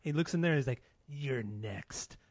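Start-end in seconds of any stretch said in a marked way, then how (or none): 1.1-2.2 low, quiet menacing threat; ominous. Retold or imitated. Drunk guest to the producers in the booth, ominous. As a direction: say it slow and dark like a veiled threat. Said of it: "Ominous and shit".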